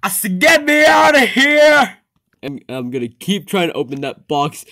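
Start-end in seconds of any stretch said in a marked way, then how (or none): 0.4-2.0 trapped shout. Acted. Trapped creature shouts from box.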